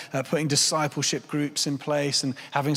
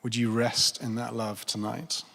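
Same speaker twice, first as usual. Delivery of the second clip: tender invitation; 4 semitones lower, 4 dB quieter than usual. Real, not acted. Tender question to congregation.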